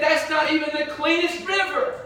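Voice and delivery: high-pitched indignant complaint; mad. Acted. Nahum objecting to washing in the Jordan, mad. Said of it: "He was mad".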